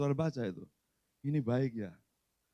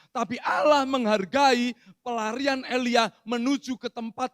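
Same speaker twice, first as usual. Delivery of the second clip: loud, high preaching; preaching at full force; 9 semitones higher, 6 dB louder than usual. Acted. Pastor preaches to the congregation, loud and fervent. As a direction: preach it out with conviction.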